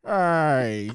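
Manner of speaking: loud drawn-out all right; stern teacher call. Retold or imitated. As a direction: call out like the band director catching you. Retold teacher's warning call.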